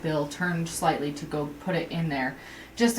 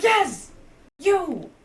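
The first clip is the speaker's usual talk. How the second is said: a much higher, louder shout that jabs at him; retold shout; 12 semitones higher, 7 dB louder than usual. Worker yelling at a confused customer, loud mock shout. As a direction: yell it right at him. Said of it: "And I'm like".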